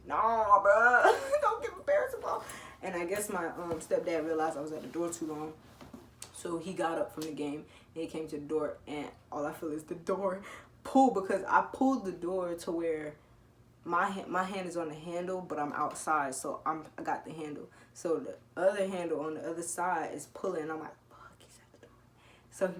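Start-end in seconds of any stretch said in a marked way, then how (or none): 0.1-2.5 loud, high laughing protest. Real, not acted. A teen laughing while telling the cop to leave her parents out. Laughing retelling cop's question. 21.1-22.0 voiceless panicked whisper. Retold or imitated. A teen whispering in panic as her stepdad pulls the door.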